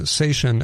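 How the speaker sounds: seitan said as SAY-shun; guest's pronunciation. Retold or imitated. Host echoes guest's unfamiliar word, seitan pronounced SAY-shun. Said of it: "first time I've, I've heard it pronounced".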